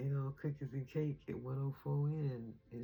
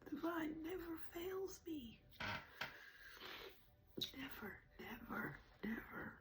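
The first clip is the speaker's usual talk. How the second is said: higher, soft, breathless whispering through tears; tearful whisper; 14 semitones higher, 11 dB quieter than usual. Real, not acted. Thanking the divine on camera while crying, whispering. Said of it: "I knew I would be crying".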